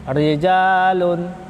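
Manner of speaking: slow "rijalun" with a thin single-tap r. Shown as a demonstration. Teacher modelling the thin r, slowly. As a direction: draw out "rijalun", keep the r light. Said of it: "dia dibaca ro-nya tipis".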